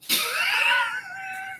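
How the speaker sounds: high burst of real laughter. Real, not acted. Laughing at own story.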